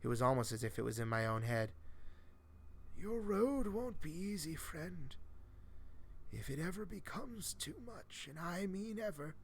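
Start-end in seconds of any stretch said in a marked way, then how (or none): 3.0-9.4 put-on higher, softer old man's parting words; a ghostly mentor, soft and knowing; eerie old man. Old man's voice in the narrator's head, soft and higher.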